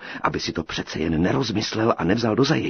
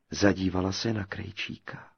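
ordinary delivery

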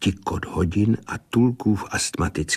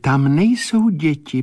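firm clairvoyant correction, pitched up; higher, steadfast seer's voice; unwavering; 9 semitones higher, 5 dB louder than usual. A narrator's reading. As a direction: carry on unshaken in a higher voice. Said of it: "pokračovala neochvějně".

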